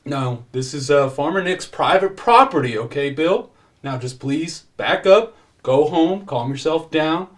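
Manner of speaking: exasperated peacekeeping cop; police officer pleading. Acted. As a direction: urge an angry man to back off. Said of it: "Earl says".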